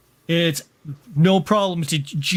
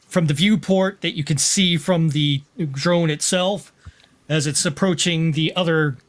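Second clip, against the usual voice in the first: ordinary delivery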